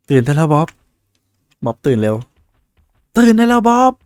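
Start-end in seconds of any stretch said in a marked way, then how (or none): 3.2-3.9 much higher and louder, calling out hard; loud shout. Real, not acted. Gamer reading the game's wake-up line, loud shout. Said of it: "ผมอ่านนะ ขอโทษ เสียงดัง".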